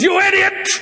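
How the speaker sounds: high-pitched mock furious yelling; acted scream. Man screams at prank caller, high enraged shout. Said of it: "A guy screams".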